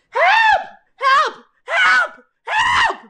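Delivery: very high, loud panicked mock screams; yelling for help. Panicked sister yelling to the cows, shrieked cries for help. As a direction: imitate a panicked sister screaming in separate bursts. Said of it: "she's yelling".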